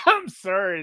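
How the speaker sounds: high, loud laughing apology; cracking up. Real, not acted. Player broken by the medic joke, cracking up. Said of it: "that just broke me".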